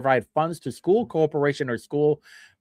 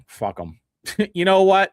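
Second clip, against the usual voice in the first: ordinary delivery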